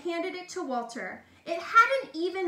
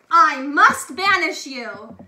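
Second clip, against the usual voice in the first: loud stern verdict; stern decree; 12 dB louder than usual. A narrator's reading. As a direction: pronounce sentence loudly. Duke decides banishment.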